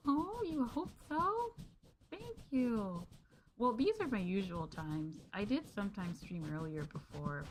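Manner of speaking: ordinary delivery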